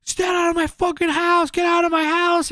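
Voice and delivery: high-pitched mock yelling at no great volume; imitated angry yell. Homeowner orders strangers out of his house, mock-angry.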